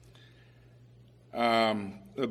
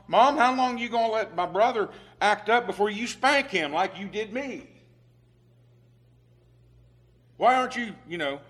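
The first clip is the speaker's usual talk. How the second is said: whiny tattling brother; 6 semitones higher than usual. Retold or imitated. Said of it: "one brother saying to his mom".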